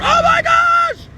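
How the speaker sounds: high, drawn-out shout of alarm; startled shout. Real, not acted. Driving the buggy down to the river, alarmed shout. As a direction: yelp as the buggy lurches.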